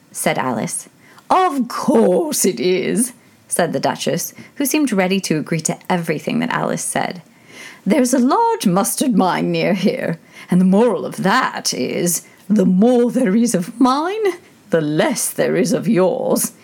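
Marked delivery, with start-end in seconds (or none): 1.3-3.1 lightly voiced old duchess; a hint of a fussy old duchess; frail withering duchess. A narrator's reading. 7.9-16.5 lightly voiced old duchess; a hint of a fussy old duchess; frail withering duchess. A narrator's reading.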